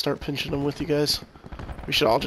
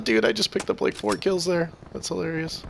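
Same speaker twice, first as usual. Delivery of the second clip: ordinary delivery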